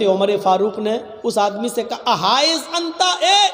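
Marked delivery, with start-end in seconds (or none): 2.1-3.5 higher, louder call peaking on اے; shouted call. Retold or imitated. Umar shouts after the man, loud and high. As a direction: yell after him to make him turn.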